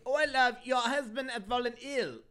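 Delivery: high, loud hammed-up Cockney; bad Cockney accent. Killer calling the wife in a bad Cockney accent. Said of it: "that was not a good accent".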